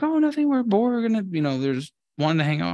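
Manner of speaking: high airy innocent voice, easing down; mock innocent girls.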